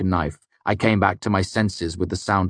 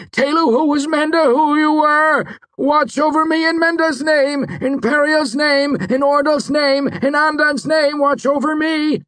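fervent shouted prayer; 6 dB louder than usual. A narrator's reading. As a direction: cry the names out to the sky. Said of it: "as he continued to pray".

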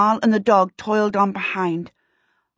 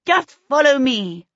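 higher-pitched gleeful urging; 5 semitones higher than usual. A narrator's reading. Runaway urging his pursuers onward, gleeful. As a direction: urge them on with glee. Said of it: "he said gleefully".

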